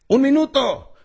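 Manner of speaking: grita el aviso, agudo y fuerte; aviso gritado. Acted. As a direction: llamada urgente a la puerta. Aviso gritado tras tocar puerta. (aviso a gritos antes de salir a escena)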